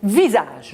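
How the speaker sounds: visage with the middle S voiced as z; pronounce z. Shown as a demonstration. Teacher modelling visage with a voiced z. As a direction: say visage with a clear buzzing z. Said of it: "le S entre le I et le A se prononce ze".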